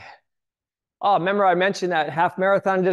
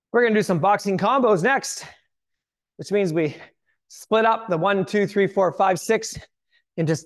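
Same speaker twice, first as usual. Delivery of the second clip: aerobics instructor. Acted. Workout cueing resumes.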